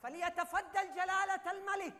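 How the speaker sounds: slow, grand herald announcing the king; royal announcement. Acted. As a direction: proclaim it slowly like a court crier. Announces the king's entrance. (إعلان ملكي بطيء ومفخم)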